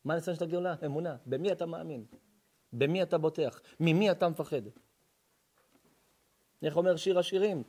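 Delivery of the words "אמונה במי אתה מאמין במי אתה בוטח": ordinary delivery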